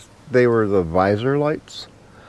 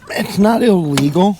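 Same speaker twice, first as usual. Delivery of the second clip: strained, effortful voice from hip pain; grunting with effort; 9 semitones higher, 5 dB louder than usual. Real, not acted. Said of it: "I'm kinda grunting and strutting".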